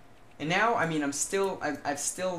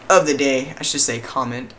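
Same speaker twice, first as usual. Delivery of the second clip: hiccups; 11 dB louder than usual. Real, not acted. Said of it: "Stop hiccuping".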